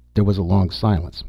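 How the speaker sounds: plain reading voice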